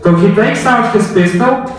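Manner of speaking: dramatic storytelling. A narrator's reading. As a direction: build the reveal like a storyteller.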